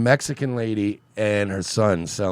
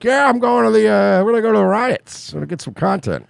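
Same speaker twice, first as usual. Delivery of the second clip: higher-pitched put-on voice, easing down at the end; pitched-up comic impression; Vito; 9 semitones higher than usual. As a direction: do an impression starting high, then dropping. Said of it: "That's my Vito impression".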